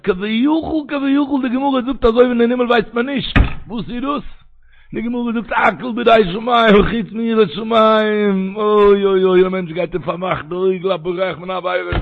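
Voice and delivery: high, loud, drawn-out sermon cry; impassioned preaching. Acted. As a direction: stretch the words out with fervour. Preaches at full force.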